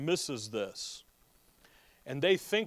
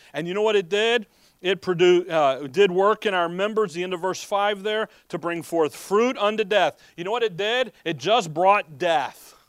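rising, louder sermon drive; fiery preaching; 4 semitones higher, 6 dB louder than usual. Acted. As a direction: preach it hard to the congregation. Preacher building to full force.

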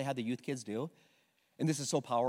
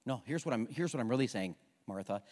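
gentle correction; 6 semitones lower, 3 dB quieter than usual. Acted. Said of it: "Jesus is like".

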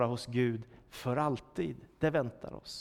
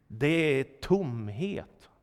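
slow, weighty Det är tomhet; slow and grave; 2 semitones lower, much slower than usual. Shown as a demonstration. Preacher stressing life without God, slow and grave. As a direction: let Det är tomhet sink in slowly. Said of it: "emptiness stated slowly, then repeated".

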